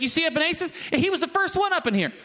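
high, playful boast about a friend; excited bragging. Acted. Voicing Paul bragging on friend.

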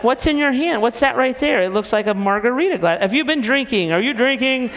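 mock accusing husband. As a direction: grill her like a suspicious husband. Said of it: "And then I'm like".

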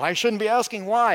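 high-pitched mock self-reproach; imitated guilty protest; mock guilt. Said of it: "we have this unnecessary guilt".

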